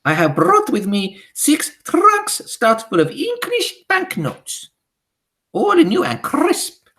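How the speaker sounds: high, loud witch voice with a foreign lilt; high imperious witch decree; Grand High Witch. Acted. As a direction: command the witches like a tyrant queen.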